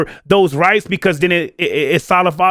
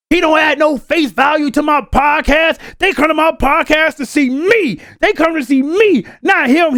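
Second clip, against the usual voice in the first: mad rant; 7 semitones higher than usual. Retold or imitated. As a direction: imitate an indignant rant, high and slow. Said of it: "[name] gets mad ... start talking shit".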